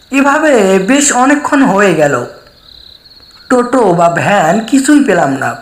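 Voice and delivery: ordinary delivery, nothing marked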